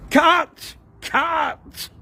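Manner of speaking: very high, loud cut call; shouted. A narrator's reading. Neil the director yelling cut, very high and loud. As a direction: stop the take with a yell. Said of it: "shouted Neil".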